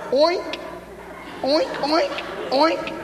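high, rising little pig oinks; piggy oinks. Retold or imitated. Little Randy answering his mother with high piglet oinks. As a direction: oink like a playful piglet.

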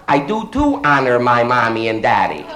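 usual speaking voice

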